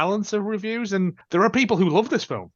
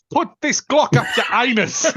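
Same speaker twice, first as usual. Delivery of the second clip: put-on South African accent, laughing; 7 semitones higher, 5 dB louder than usual. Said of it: "in a South African accent".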